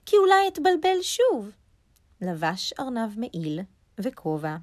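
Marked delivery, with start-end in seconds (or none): 0.1-1.5 high, louder rabbit thinking aloud. A narrator's reading. Rabbit decides against writing a sign, high voice.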